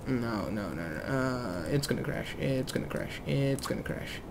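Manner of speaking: very soft anxious muttering; near-whispered worry, repeated helplessly; hushed dread. Real, not acted. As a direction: mutter under your breath as a crash looms. Fears the plane will crash.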